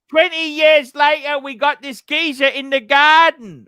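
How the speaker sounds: high, loud put-on London accent; botched Yorkshire accent, London-sounding. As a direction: shout it like a hammed-up London geezer. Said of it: "That's a London accent".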